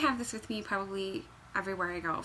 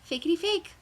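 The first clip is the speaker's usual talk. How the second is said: playful singsong; 7 semitones higher, 4 dB louder than usual. Acted. Playful chant over fake fur.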